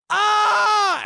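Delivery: sudden high loud yell of delight; joyful shout. Acted. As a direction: burst out with a high gleeful cry. Shout after floating disc quote.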